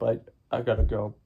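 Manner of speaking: usual speaking voice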